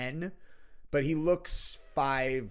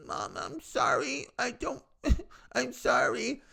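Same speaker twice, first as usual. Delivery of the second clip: whimpering son; 10 semitones higher, slower than usual. Retold or imitated. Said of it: "both just like".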